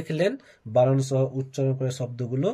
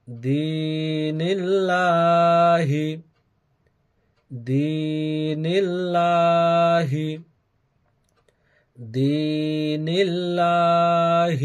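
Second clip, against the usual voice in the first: drawn-out melodic Quranic recitation, slow; stretched chanted Arabic with long held vowels; slow tajweed recitation; 5 semitones higher, 6 dB louder, much slower than usual. Shown as a demonstration. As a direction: recite each phrase slowly and lingeringly, as a model to copy. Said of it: "উচ্চারণগুলো আমি শুধু বলছি কয়েকবার করে".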